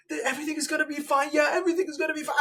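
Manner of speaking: fast, high mock reassurance; mocking soothing voice. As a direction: rush through a sugary comfort. Mocking Kamala hugging woman.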